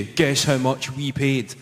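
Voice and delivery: usual speaking voice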